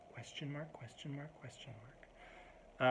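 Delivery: very soft, offhand mutter; passive toss-off. Retold or imitated. Annoyed person throwing it out to you, softly passive. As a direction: toss it out under your breath.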